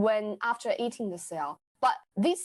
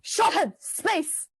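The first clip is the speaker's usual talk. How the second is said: slow, louder spell-like command; magic command; 5 semitones higher, 4 dB louder, slower than usual. Retold or imitated. Scientist demonstrating the power, a slow louder magic command. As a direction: call it out like an incantation. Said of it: "and then you said".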